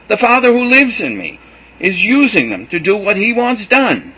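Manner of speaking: loud high exasperated pleading throughout; impassioned cry. A narrator's reading. Jesus pleading with disciples, loud, high and impassioned. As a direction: press every line with passion. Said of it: "cried Jesus".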